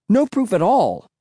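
high, emphatic shout. A narrator's reading. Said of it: "I shouted".

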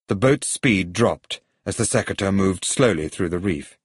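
ordinary delivery, nothing marked

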